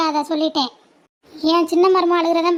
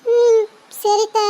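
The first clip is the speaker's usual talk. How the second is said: slow, sobbing reluctant yes; 3 semitones higher, much slower than usual. Real, not acted. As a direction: squeeze out an okay between real sobs. Child agrees while crying.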